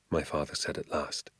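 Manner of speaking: usual speaking voice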